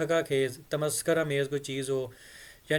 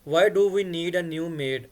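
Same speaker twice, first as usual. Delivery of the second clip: slow model reading; 4 dB louder, much slower than usual. Shown as a demonstration. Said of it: "हमें नई मुलाजमा की क्यों ज़रूरत पड़ी".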